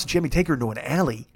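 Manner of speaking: ordinary talk, nothing marked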